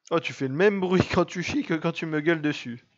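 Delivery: high, loud, laughing jibe; amused teasing. Real, not acted. Owner teasing a noisy pet, laughing as they speak. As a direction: break into laughter while teasing loudly.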